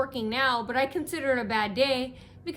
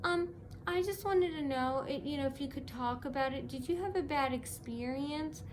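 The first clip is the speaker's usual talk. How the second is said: mimicking the lady; 3 semitones higher, 6 dB quieter than usual. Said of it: "And she goes".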